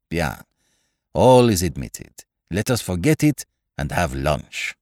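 put-on French-accented little detective, animated pitch swings; Poirot, urging. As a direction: coax a friend in a French accent. Poirot voiced in story.

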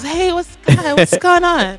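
high, slow, overly sweet greeting; fake innocent. Sister fakes innocence to her brother's date, sugary high greeting. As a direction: play innocent like you just woke up. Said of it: "I'm acting like I was".

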